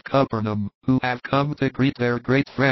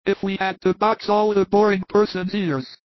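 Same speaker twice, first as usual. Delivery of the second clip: higher-pitched, placid character voice; very calm Thomas; 8 semitones higher than usual. Acted. Thomas answers the irritated Iscariot, very calmly. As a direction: reason it out unruffled. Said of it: "says Thomas very calmly".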